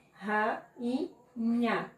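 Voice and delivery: "Ra, i, nha" syllable by syllable; separando sílabas. Shown as a demonstration. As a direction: pause between each part of "Ra, i, nha". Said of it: "quando separar a palavra em sílaba". ("Ra, i, nha" sílaba por sílaba)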